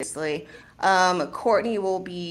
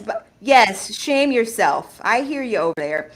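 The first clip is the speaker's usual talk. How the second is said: normal talking voice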